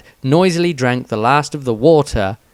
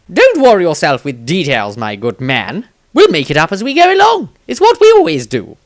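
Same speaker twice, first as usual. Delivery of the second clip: louder, much higher, quick and flippant wizard; breezy Maestro; 6 semitones higher, 8 dB louder than usual. A narrator's reading. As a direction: toss it off with carefree bravado. Maestro voiced as character.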